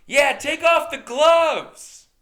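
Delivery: put-on high, loud, drawn-out drunken shout; drunk crowd yell. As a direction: holler like a wasted crowd. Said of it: "Drunk people in the crowd start going".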